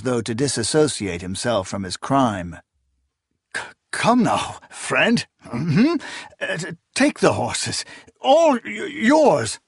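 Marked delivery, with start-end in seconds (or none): 3.6-9.6 frightened stutter, much higher; stammer, pleading for his life; stammering fear. A narrator's reading. Officer stammering in terror.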